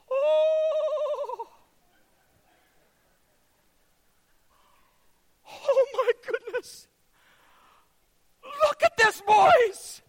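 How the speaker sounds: high, loud astonished cry; hammed-up wonder at a trick; showman's gasp of amazement; mock amazement. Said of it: "He says".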